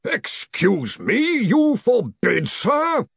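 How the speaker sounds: loud, drawn-out, high indignant disbelief; sudden fury. A narrator's reading. Arch-Chancellor turning on the man who forbade him, furious. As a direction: stretch each word in furious incredulity. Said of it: "turning on Greenham in sudden fury".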